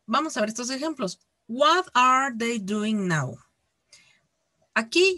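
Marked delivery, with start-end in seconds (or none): 1.5-3.4 slow, measured English model sentence; example read-out. Shown as a demonstration. Teacher reading an example question to the class, slow read-out. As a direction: read it out slowly for learners.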